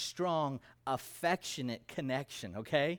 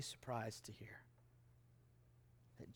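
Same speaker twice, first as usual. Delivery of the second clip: hushed; 5 semitones lower, 19 dB quieter than usual. Real, not acted. Sermon warning, hushed.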